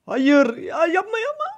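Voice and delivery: high, pleading cry; desperate plea. Real, not acted. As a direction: beg them to stop. Begging enemy not to kill. (yalvaran, tiz çaresiz çığlık)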